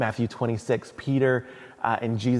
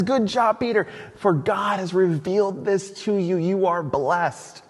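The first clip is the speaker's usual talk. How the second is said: praise; 8 semitones higher than usual. Acted. Jesus praising Peter.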